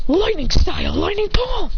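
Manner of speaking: high anime attack call; technique call. Acted. Fighter calls out his technique.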